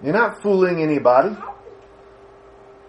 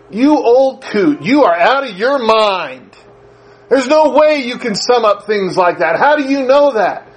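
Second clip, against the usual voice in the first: put-on exasperated young son; 6 semitones higher than usual. Son scoffing at his mother's judgment, high and indignant. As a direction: whine at her like a disbelieving teenager. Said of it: "I used to think she was crazy".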